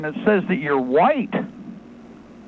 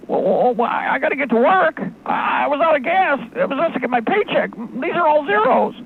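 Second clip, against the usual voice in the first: higher, near-crying, rushed plea; panicked caller; 7 semitones higher than usual. Pretend. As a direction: beg like your money just vanished. Caller panics about empty account.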